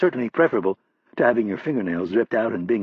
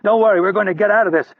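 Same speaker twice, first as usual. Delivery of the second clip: much higher, loud call across the room; yelled; 9 semitones higher, 5 dB louder than usual. Acted. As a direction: shout to reassure him. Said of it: "Rapp saw Hurley and yelled".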